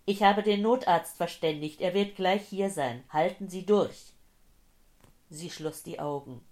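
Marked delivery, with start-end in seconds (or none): 0.1-4.1 loud, raised, insistent reassurance; urgent shouting. Acted. Old man to injured woman awaiting help, loud and urgent. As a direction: shout to keep them holding on.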